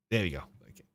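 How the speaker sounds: usual speaking voice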